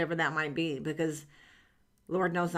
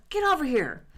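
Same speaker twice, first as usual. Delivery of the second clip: put-on higher, louder old man calling across the room; dad calling out; 9 semitones higher, 7 dB louder than usual. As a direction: bark it out as her father summoning her. Dad summons her.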